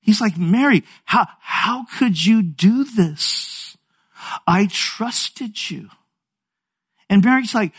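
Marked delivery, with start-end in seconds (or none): 0.4-5.9 slow, higher-pitched acted reproach; hurt bewilderment. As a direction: imitate a betrayed husband, drawing the words out. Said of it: "to anger, to bewilderment".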